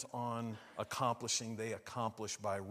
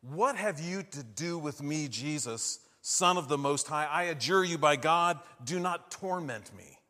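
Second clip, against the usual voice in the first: crying out loud and higher, pleading; the possessed man cries out to Jesus, loud; 4 semitones higher, 9 dB louder than usual. Acted. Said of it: "The man's crying out in a loud voice".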